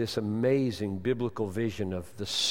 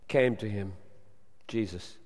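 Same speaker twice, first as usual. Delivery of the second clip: ordinary delivery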